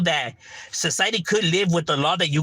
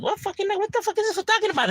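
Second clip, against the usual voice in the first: high-pitched incredulous squeal of disbelief; shocked disbelief. Retold or imitated. Kid to cops, stunned by the planted knife, high disbelief.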